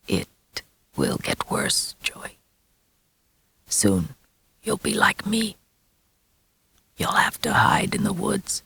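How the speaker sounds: whisper. A narrator's reading. Werewolf father whispering the boy's fate into his ear. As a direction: whisper it like a curse. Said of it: "whispering Joey's future relentlessly".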